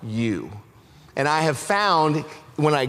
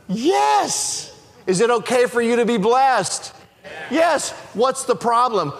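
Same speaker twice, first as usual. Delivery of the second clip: high, emphatic pulpit proclamation; fired-up preacher driving the point home; full-force preaching; 7 semitones higher than usual. Real, not acted. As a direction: preach it out with raised pitch, drawing out key phrases.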